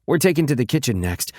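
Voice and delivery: plain reading voice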